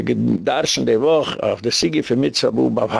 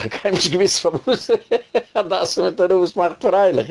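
laughing through the words, pitch raised; real laughter; 6 semitones higher than usual. Real, not acted. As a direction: crack up while trying to keep talking. Cracks up at own joke. (לאַכנדיק בײַם רעדן)

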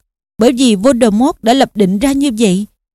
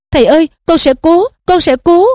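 higher-pitched, flustered reassurance; frantic; 5 semitones higher than usual. A narrator's reading. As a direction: promise it in a panic. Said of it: "Harry cuống quýt nói". (cuống quýt)

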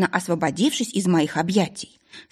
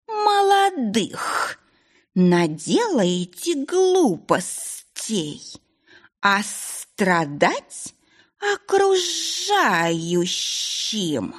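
very slow, higher scolding creature voice; grumbling snake; 4 semitones higher, much slower than usual. A narrator's reading. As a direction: scold the young ones like an old nanny. Snake familiar scolds the couple. (ворчливая змейка)

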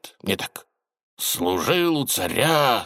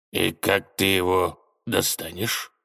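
slow, low sinister question; slow villain menace; 3 semitones lower than usual. Acted. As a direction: press him with slow menace. Тень допрашивает министра. (медленно, зловеще)

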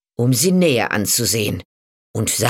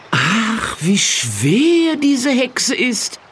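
higher, louder, hammed-up complaining; loud for show; 8 semitones higher than usual. A narrator's reading. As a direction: overplay it so the witch hears. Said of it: "Laut sagte er".

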